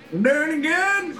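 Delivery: dopey boater voice, mocked; mocking the boater. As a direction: make him sound clueless. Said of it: "he's like".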